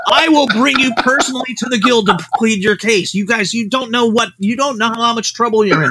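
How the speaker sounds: a high-pitched, agitated impression of an angry old writer; Harlan ranting. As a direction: play the panicked mentor blasting warnings. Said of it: "Harlan's like".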